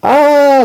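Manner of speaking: high, loud "Ah" scream; long piercing scream of alarm; teacher's scream. A narrator's reading. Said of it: "screamed the teacher".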